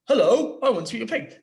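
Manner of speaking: loud, high call to the house; wolf calling out. Shown as a demonstration. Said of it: "the wolf walks up to the house and says".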